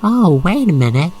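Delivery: ordinary talk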